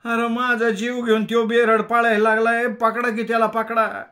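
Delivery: high, loud rustic yell; milkmaid shouting. A narrator's reading. The milkmaid yells at the crowd to grab the running man. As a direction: yell to the crowd to catch him. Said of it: "ती गवळण ओरडली".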